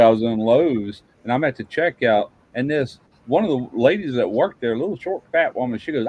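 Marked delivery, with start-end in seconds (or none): none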